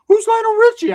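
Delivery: a silly high imitation of forgetful Ricky; mocking Ricky. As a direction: squeal the question way up high, baffled. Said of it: "he's like".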